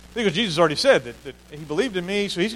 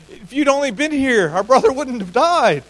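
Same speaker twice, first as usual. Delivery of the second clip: pleading grief; 7 semitones higher, 7 dB louder than usual. Acted. Mary's grief voiced.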